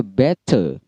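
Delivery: "better" with a clear crisp T, R weak; British better. Shown as a demonstration. Teacher demonstrating British "better" to students. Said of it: "T selalu jelas".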